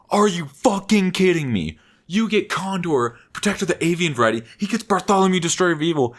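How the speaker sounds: high-pitched indignant tirade; hammed-up, incredulous tantrum in a high voice; mock outraged rant. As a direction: imitate a friend going off about being cheated. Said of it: "he went off".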